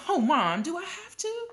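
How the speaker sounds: high, reluctant whine; whining kid. A narrator's reading. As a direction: whine to Mom to get out of it. Boy whines about a chore.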